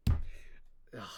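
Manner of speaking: ordinary delivery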